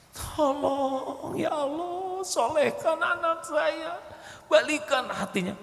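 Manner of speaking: high, slow, tearful begging to God; pleading prayer. Acted. Worshipper begging God for their child, high tearful pleading. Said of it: "memelas seperti itu dalam doa".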